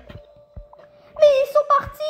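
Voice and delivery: very slow, high, loud wail; mock wail. Father left behind by his daughter, hammed-up wail. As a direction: stretch each word into a hammed-up lament. Father wails after they leave.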